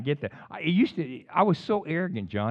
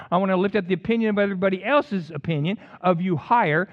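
usual speaking voice

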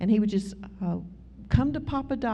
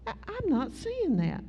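higher, softer half-whispered disbelief; stunned disbelief; 8 semitones higher, 6 dB quieter than usual. Retold or imitated. Driver sees a peacock beside her car, hushed disbelief. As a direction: mutter it like you can't trust your eyes. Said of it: "and I was like".

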